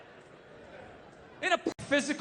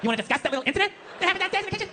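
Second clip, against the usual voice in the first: wife's voice; 6 semitones higher, much faster than usual. Retold or imitated. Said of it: "she's just like".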